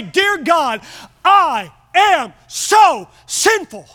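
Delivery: shouted confession. Acted. The preacher shows the congregation how to confess, shouting slowly.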